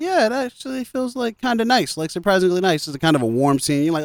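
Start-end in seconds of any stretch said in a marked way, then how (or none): 0.0-2.9 much higher, light, pleased voice; shy sweet reply. Retold or imitated. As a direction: lift the voice, touched and shy. Carrie answering the kind teacher.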